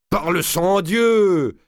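slow, high, loud oath; exploding oath. A narrator's reading. Valois bursts out before the king, a slow loud oath. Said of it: "s'écria-t-il".

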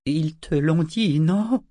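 slow, hesitant, rising question; awkward faltering. A narrator's reading. Woman breaks news to the ship, awkwardly. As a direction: feel your way uneasily to a rising tag. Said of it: "bafouilla-t-elle avec maladresse".

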